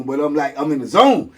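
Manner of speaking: ordinary talk, nothing marked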